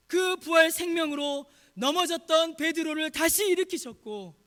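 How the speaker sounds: high, emphatic pulpit proclamation; impassioned preaching. Real, not acted. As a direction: proclaim it forcefully to the congregation. Preaches at full force.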